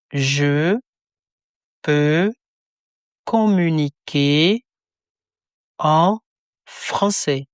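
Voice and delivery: each word spaced apart for repetition; slow word by word. Shown as a demonstration. Teacher splitting the phrase into slow separate words. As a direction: break it into slow single words.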